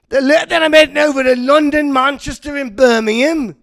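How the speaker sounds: put-on playful British accent on the UK tour stops. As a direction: put on a cheesy British accent for the city names. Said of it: "when the accent thing bombs".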